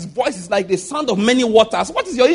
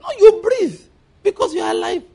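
emphatic shout; 9 semitones higher than usual. Real, not acted. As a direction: cry it out to prove the point. Rebuttal shout.

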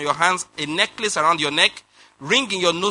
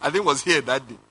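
ordinary delivery

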